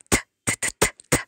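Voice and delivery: "t" said as a soft, unvoiced "th"; isolated th sound. Shown as a demonstration. Teacher showing the drummer's sound, soft repeated th. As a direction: tap out "t" as a string of short "th" sounds. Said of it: "you will hear the sound".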